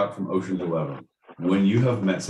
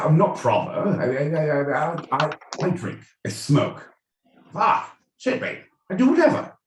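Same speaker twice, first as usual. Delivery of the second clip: crass Cockney caricature, pitched up; mock Cockney; 6 semitones higher than usual. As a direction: play a rough Cockney who doesn't care. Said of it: "the Cockneys is".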